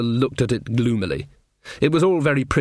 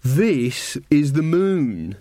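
haughty teacher; much slower than usual. Acted. Diamanda lectures the coven.

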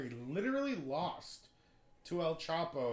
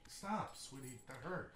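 tender; 4 semitones lower, 10 dB quieter, slower than usual. Real, not acted. Softly telling a pet to stop.

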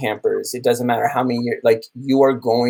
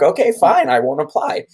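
higher, louder, mock-offended "fine then"; mock huffy reply; 8 semitones higher, 3 dB louder than usual. Retelling a friend's put-down, higher mock-huffy reply. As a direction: toss it off like a sulky retort, playfully. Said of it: "And I'm like".

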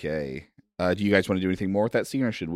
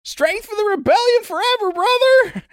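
put-on high zealous child voice, louder; piping kid cheer, much higher; little kid; 8 dB louder than usual. Little kid cheering the stranger, high zealous child voice.

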